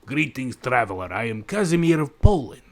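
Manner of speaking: hammed-up, unconvincing Polish accent; attempted Polish accent. Player reading Casimir's greeting, attempted Polish accent. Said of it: "don't even think that was a Polish accent".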